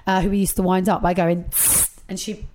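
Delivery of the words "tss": soft hissing spray noise through teeth; quiet aerosol hiss. Retold or imitated. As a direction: make a deodorant spray sound. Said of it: "that's me making a noise with my mouth".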